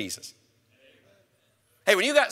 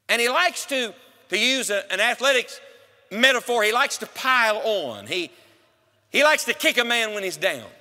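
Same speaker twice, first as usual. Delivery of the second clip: ordinary talk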